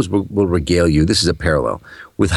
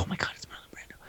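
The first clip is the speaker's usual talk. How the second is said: whisper; 20 dB quieter, faster than usual. Retold or imitated. Woman spotting Brando in shop.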